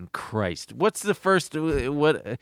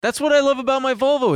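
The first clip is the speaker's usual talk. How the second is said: excited; 12 semitones higher, 4 dB louder, faster than usual. Real, not acted. Gushing about his analog car.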